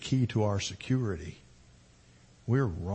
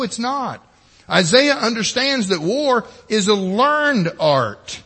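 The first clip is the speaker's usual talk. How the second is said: forceful preaching; 9 semitones higher, 5 dB louder than usual. Acted.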